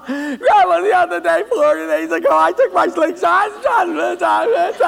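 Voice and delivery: high, loud, slow sobbing confession; boy confessing through tears; crying confession. Acted. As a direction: blubber out the whole story. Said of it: "he came crying to Grandpa".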